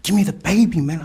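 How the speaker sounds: high, loud reenacted plea; urgent demand. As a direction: raise the voice, pleading urgently. Retold urgent demand for baby.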